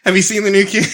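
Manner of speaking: usual speaking voice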